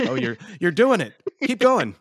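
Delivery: high, loud eager cheering; excited. Real, not acted. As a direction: egg them on with delight. Host starts singing.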